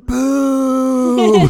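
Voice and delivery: high, very loud drawn-out boo; hammed-up ghostly boo; scare shout. Moogie jumping out at a visitor, playful scare. Acting out scaring someone.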